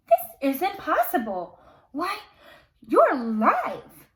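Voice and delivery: stunned candle character exclaiming; astonished gasp. Acted. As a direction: cry out in disbelief. Candelabrum shocked at visitor.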